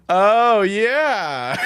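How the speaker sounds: dry mocking "oh yeah" with a laugh; sarcastic. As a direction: sneer it out, unimpressed. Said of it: "that very sarcastic".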